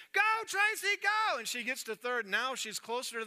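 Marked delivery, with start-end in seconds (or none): 0.1-1.4 high, loud rallying yell; coach shouting. Acted. Third base coach waving her home, high loud shout. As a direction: holler her toward home. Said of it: "coach at third base".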